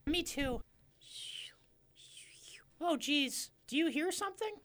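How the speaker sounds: very high squeaky fisherman. Acted.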